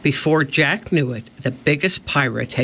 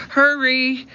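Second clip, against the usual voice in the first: high, urgent call; calling back; 11 semitones higher than usual. A narrator's reading. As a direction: call out high to someone lagging behind. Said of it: "Annie called back to him".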